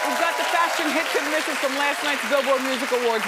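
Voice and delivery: high, loud upbeat host announce; show announcer. Acted. Host promotes the royal wedding segment, upbeat announcer read. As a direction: sell the next segment to the audience.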